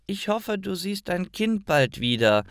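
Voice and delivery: put-on a light child's voice bidding goodbye; kind child. A narrator's reading. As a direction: wish her well like a young girl. Child says farewell to woman.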